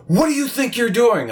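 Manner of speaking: retelling the homeowner's angry yell at the worker; mock angry yell. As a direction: imitate the furious homeowner yelling. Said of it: "she comes out yelling at me".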